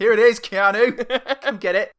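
high, loud mock call. [name] hollers to Keanu from the car, calling out. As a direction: call him over, pitched right up.

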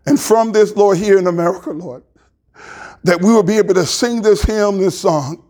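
pastor closing in humble prayer; heartfelt prayer. Real, not acted. Pastor prays aloud to God.

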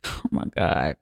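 a lightly mimicked horrified hush; freaked-out gasp. Son watching his mom possessed, whispered horrified gasp. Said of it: "He just freaking the fuck out".

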